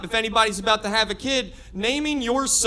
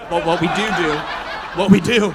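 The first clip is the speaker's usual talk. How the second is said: laughing; 3 semitones lower, faster than usual. Real, not acted. Laughing at own joke.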